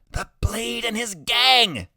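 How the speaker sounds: high, drawn-out alarmed cry; boy announcing approaching danger; urgent warning. A narrator's reading. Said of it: "Freak hisses".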